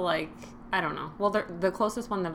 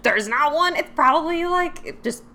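dismissive; 3 semitones higher, 6 dB louder than usual. Retold or imitated. Acting out her own thinking.

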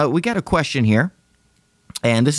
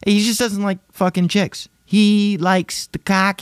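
high mocking voice; 10 semitones higher than usual. Mocking the guy's preferences.

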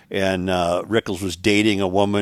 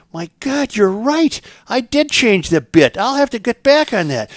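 higher, eager farmer insisting; 11 semitones higher, 4 dB louder than usual. Acted. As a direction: push the generous host, pitched up high. Farmer amazed in joke.

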